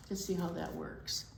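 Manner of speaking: plain reading voice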